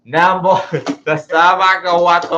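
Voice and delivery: loud, high mock outburst; slowed-down, high-pitched rant played for laughs; retold frustrated outburst. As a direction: raise your voice in comic frustration. Mimics own outburst at the microphone.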